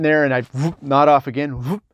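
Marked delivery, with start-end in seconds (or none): none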